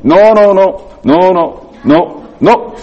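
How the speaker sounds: fussy mock scolding no; imitating a fussy cook saying no; Raj's warning no. Mimicking Raj's warning while reaching.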